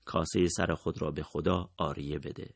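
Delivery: murmured refrain. A narrator's reading. Comrade repeating a line for battle, softly murmured. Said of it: "این زمزمه افتاده بود به دهانش".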